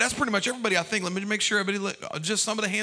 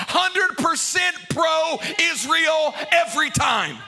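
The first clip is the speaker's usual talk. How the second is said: fiery preaching; 4 semitones higher than usual. Acted. Preaching at full force.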